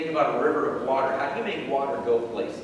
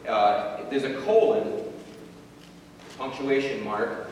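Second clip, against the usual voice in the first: normal talking voice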